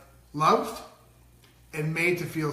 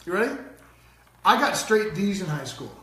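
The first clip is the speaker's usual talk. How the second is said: ordinary talk, nothing marked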